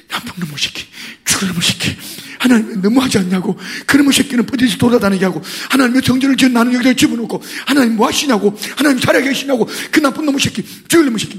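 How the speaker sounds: building from muttered curses to loud, fast ranting; angry cursing prayer. Retold or imitated. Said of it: "욕을 막 사정없이 했다".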